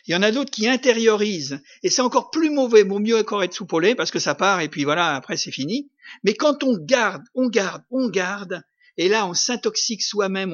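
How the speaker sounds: swelling pulpit delivery; fervent preaching. Acted. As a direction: preach it with full force to the congregation. Preaching at full force.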